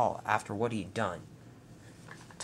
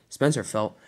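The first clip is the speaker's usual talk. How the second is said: plain reading voice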